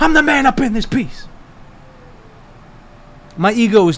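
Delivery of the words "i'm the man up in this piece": higher-pitched, defiant movie-tirade quote; Denzel impression. Streamer echoing Denzel's Training Day rant, losing it. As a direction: boast it like a man losing it. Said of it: "I was definitely Denzel at the end of Training Day".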